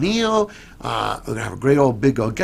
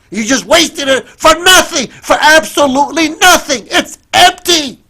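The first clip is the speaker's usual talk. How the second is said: loud, high furious scolding; father yelling at his little daughter, furious; angry yelling father; 11 semitones higher, 8 dB louder than usual. Retold or imitated. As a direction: scold her like an enraged dad. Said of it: "began to yell and scream and say".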